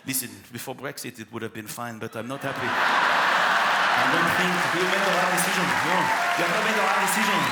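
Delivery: put-on haughty French-accented dog; snooty poodle voice. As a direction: sniff at him, offended. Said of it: "French poodle".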